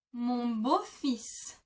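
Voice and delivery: "Mon beau-fils" said very slowly, each sound clear; drawn out so learners catch "beau-fils"; slow French demo. Shown as a demonstration. Teacher modelling the word for learners. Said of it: "you would say".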